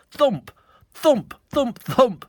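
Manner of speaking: loud high thudding beats; loud thumps. A narrator's reading. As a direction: pound out each thump. Rain of thumps.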